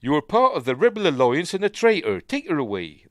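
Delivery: put-on high, loud Bristol accent; bad Vader line in broad Bristol; David Prowse. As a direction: West Country Bristol accent, loudly declaimed. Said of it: "That was what his accent was like".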